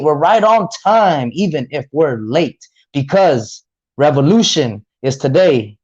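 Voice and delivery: rhythmic, emphatic poem delivery; spoken word poetry. Acted. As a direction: perform it like a slam poet.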